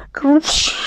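spluttered cough-like whoosh; imitated cough. A narrator's reading. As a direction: imitate a cough with a long hissing end. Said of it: "I had a cough".

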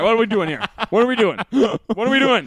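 rising, high-pitched confrontational demand; mock exasperation. Man confronting someone a third time, mock exasperation.

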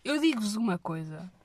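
ordinary delivery

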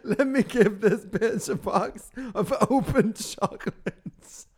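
mock quote through laughter; cracking up while quoting him; laughing impression. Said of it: "And then he said".

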